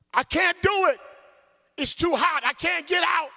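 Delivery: put-on high, frightened child's cry; scared child screaming. Little boy trapped behind the window, screaming in fear. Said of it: "screamed through the glass".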